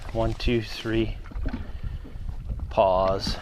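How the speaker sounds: low, measured count with gaps; counting out pauses. Real, not acted. Angler timing the jerkbait retrieve, measured counting.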